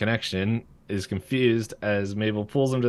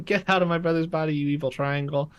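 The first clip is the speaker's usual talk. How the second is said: higher, mock yell without real volume; retold yell; 7 semitones higher than usual. Mabel yelling at Bill in her brother's body, mock yell. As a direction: imitate an indignant girl yelling, voice raised in pitch. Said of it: "Mabel's yelling".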